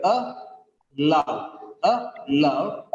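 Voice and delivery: "a love" broken apart, light "a", strong "love"; syllable-by-syllable demo of "a love"; split into syllables. Shown as a demonstration. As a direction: break it in two, landing on "love". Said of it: "It is a disyllabic word".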